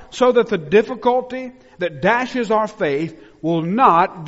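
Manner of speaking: loud, high, ringing pulpit voice; full-force preaching. Acted. Pastor preaching hard to his church, loud and high. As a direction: preach it out with fire to the congregation.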